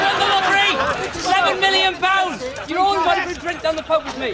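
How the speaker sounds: high, loud gleeful boasting; excited shouting. Acted. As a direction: announce the win to the whole pub. Boasting in the pub.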